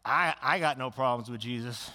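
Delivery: lightly voiced grateful person; content believer. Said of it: "you're like".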